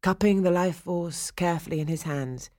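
ordinary delivery

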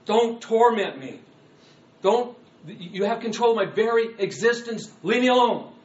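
loud, high protest; upset. Acted. Demon protesting to Christ, very upset and loud. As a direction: shout to be left alone. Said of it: "That's why he's so upset at this point".

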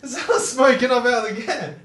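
high, loud and laughing; real amazed outburst through laughter; giddy shock. Real, not acted. Friend amazed at smoke from the can, giddy shock.

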